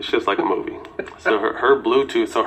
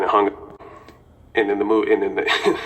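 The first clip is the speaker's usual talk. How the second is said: ordinary talk, nothing marked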